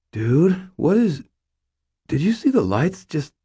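high, far-off dazed stoner voice; confused stoner. Acted. Stoned cashier asks the robber about the lights, dazed.

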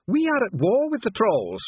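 high, emphatic dwarf protest; indignant dwarf. A narrator's reading. Dwarf reminding the envoy of war, indignant.

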